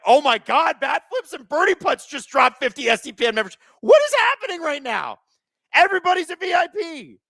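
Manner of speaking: very high, incredulous shouting; overwhelmed excitement. Real, not acted. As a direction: shout out in real amazement, voice way up. Another fifty memberships dropped.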